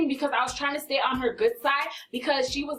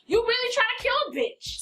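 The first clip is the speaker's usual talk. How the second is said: much higher, incredulous outrage; much higher incredulous repeat; more outraged repeat; 9 semitones higher than usual. Retold or imitated. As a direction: push it up higher in disbelief. Repeating accusation, escalating.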